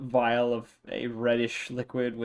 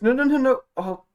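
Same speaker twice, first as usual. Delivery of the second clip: dismayed Callista; 6 semitones higher, 4 dB louder than usual. Acted. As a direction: fret and then give up on it. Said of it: "She goes".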